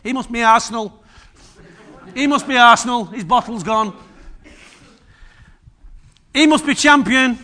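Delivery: playfully mock the believer like a football crowd; hammed-up heckling from heaven; mocking angels. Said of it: "you can imagine writing memes about us".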